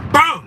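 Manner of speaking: loud, high imitated bang; gunshot bang. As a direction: punch out the sound of a gunshot. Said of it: "it sound".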